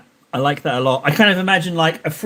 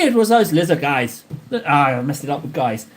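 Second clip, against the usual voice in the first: normal talking voice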